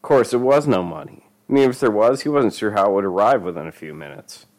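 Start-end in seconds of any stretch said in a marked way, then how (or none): none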